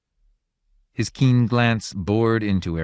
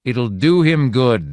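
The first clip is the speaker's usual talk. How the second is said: slow, louder god voice; 5 semitones higher, 7 dB louder, slower than usual. A narrator's reading.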